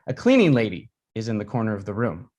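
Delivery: plain reading voice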